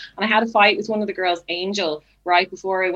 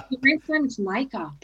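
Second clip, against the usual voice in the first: higher, half-failed Australian imitation; Australian accent; 5 semitones higher than usual. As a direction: have a shaky go at an Australian accent. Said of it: "that was my Australian accent".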